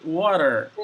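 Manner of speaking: water in American English, with R-colouring and a flapped T, WAH-der. Shown as a demonstration. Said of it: "That's American English".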